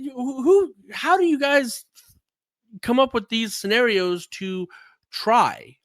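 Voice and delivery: high, loud disbelief; half-laughing amazement at the scenarios; incredulous. Real, not acted. Incredulous host asking.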